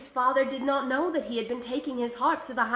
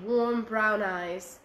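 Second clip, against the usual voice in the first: ordinary delivery, nothing marked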